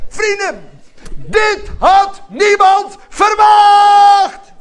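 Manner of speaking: high, loud, slow proclaiming shout; loud, high exultant preaching; fiery preaching. Real, not acted. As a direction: proclaim it to the whole church. Preaching at full force.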